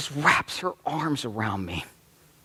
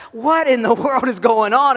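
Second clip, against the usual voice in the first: a startled laugh breaking into the question, higher and louder; laughing disbelief; 10 semitones higher, 8 dB louder than usual. Retold or imitated. Dad laughing in disbelief at his daughters chasing boys. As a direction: blurt it out half laughing at the chaos.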